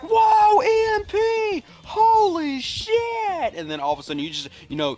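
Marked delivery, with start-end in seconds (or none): 0.0-3.5 very high, loud, drawn-out scream; mock panicked shout. Soldier running from falling choppers, imitated high drawn-out shout. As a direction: stretch each word into a hammed-up yell of alarm.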